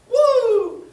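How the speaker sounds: a sudden high yelp of surprise; startled woo. Acted. A startled yelp when the couch begins shaking. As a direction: shriek as the couch starts to shake.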